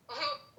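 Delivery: short high burst of relieved laughter; a quick laugh as the fear lets go. Real, not acted. Relieved after dad's calm reply.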